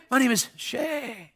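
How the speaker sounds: very soft, slowed-down introduction; imitating odd kid. Mohawked kid answers the preacher's name question, slow imitation. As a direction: drawl it out, barely audible. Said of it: "That's how he talked".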